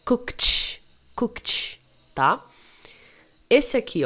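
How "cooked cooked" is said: COOKED as "cookt" with an airy T release. Shown as a demonstration. Teacher models the past of cook, ED as T. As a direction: end COOKED on voiceless KT. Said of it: "Então, no passado".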